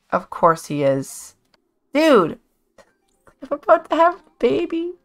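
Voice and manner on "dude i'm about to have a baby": high exasperated voice. Pretend. Voicing the sim in labor.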